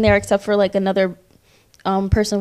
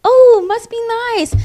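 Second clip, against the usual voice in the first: friendly reply; 13 semitones higher, 5 dB louder than usual. Retold or imitated. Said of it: "So I'm like".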